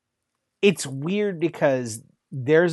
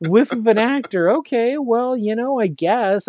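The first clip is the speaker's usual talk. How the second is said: higher, half-hearted shrug of a put-down; mock dismissive verdict; 6 semitones higher than usual. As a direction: imitate a viewer writing the actress off. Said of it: "and you're like".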